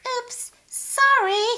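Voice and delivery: high, slow, sheepish apology; sheepish oops. Acted. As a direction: fake innocence. Sheila flees sheepishly.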